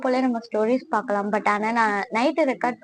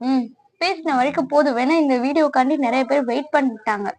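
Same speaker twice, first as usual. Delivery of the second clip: ordinary delivery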